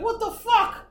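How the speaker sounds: high, loud mock outburst; exasperated self-talk. Man still awake after trying everything, mock exasperation. Acting out frustration while sleepless.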